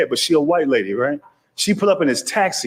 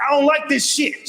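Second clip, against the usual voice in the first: acted complaint; 12 semitones higher than usual. Panicked patient phones doctor complaining.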